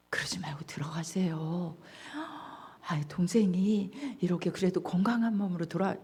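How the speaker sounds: softly coaxing whisper. Acted. Servant begging the elder son to go in, whispered. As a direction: hush it, pleading gently.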